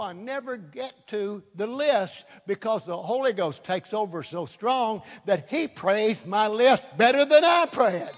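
ordinary delivery

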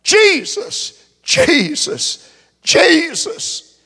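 high, loud calling out; retold cry to Jesus. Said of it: "I cried".

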